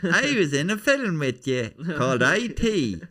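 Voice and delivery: high, loud Irish comic voice; Tommy Nicholson. Acted. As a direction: greet a film star as an overeager old acquaintance. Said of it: "That's Tommy Nicholson, who's an Irish comedian".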